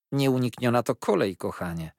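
aunt cheerfully comforting her niece, breezy. A narrator's reading. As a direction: brush it off gaily. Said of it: "mówiła wesoło ciotka".

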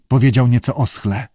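plain reading voice

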